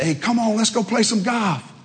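put-on high, cheery invite from old pals; cheery buddies. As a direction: call him over like a happy friend. Friends calling from golf cart.

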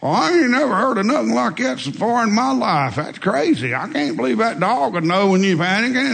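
high, incredulous loudmouth rant. Retold or imitated. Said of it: "he's just cursing like crazy".

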